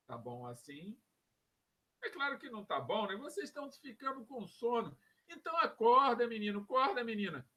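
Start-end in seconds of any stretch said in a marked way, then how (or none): 0.1-1.0 low, soft, drawn-out question with a rise; slow demonstration. Shown as a demonstration. Teacher checks the new pace with students, slow demonstration. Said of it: "eu vou falar bem devagar". 5.3-7.5 loud mock wake-up call. Teacher jokingly rousing sleepy students, loud wake-up call.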